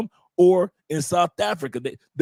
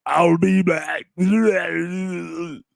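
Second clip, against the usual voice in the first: mock her sounds like a movie villain bellowing; imitating her demon noises; 2 semitones higher, much slower than usual. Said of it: "that's how she sounded".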